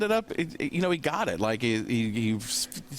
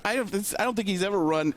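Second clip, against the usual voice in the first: ordinary talk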